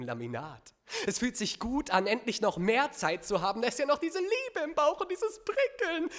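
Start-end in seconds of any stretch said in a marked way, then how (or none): 3.6-6.1 high-pitched lovey-dovey swoon; exaggerated, high infatuated gush; mock gushing. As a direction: squeal it with syrupy delight. Mock gushing, undercut by disgust.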